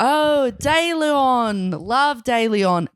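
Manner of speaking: British accent attempt. Shown as a demonstration. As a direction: try British and land nearer Australian. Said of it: "That sounds more Australian".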